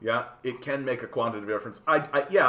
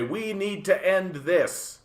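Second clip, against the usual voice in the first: slow, drawn-out mock proclamation; slow mock-activist declaration; 4 semitones higher, slower than usual. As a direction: imitate someone solemnly declaring it, higher and slow. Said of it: "People stand up and say".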